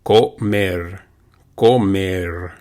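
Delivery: comer ending on a rolled Spanish R. Shown as a demonstration. Teacher drilling comer for students, rolling the R. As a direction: roll the R at the end of comer. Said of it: "you have that rolling R".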